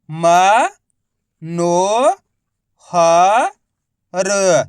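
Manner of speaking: म, नो, ह, र each held long, with pauses; syllable by syllable. Shown as a demonstration. Teacher showing the method, म नो ह र drawn out. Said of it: "एक ही अक्षर का उच्चारण करके".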